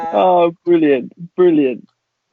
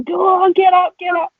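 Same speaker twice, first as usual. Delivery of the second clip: egging on; 3 dB louder than usual. Retold or imitated. Friends urging him to karaoke.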